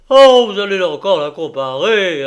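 loud, lifted protest; exclaimed interruption. A narrator's reading. Stello interrupts the doctor's description, exclaimed protest. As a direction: cut in with a loud objection. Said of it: "interrompit Stello".

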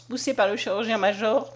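ordinary delivery, nothing marked

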